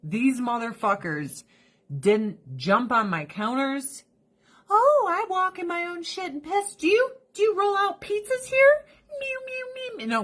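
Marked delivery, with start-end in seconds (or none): 4.7-10.2 much higher mock cat, ending in meows; snotty cat voice. Owner mocking her cats on the counter, high and snotty. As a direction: whine it like a spoiled cat.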